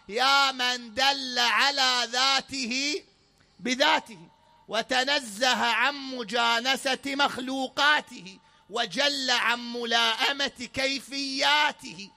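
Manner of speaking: slow, emphatic prayer line; preaching recitation. Acted. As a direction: proclaim each word of the prayer. Reciting Dua al-Sabah slowly. (تلاوة دعاء بقوة وبطء)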